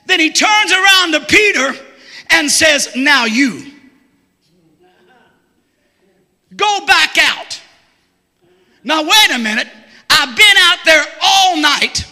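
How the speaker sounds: loud, slow, building sermon shout; full-force preaching. Acted. Preacher shouting the story of Peter to his church. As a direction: preach it out to the rafters.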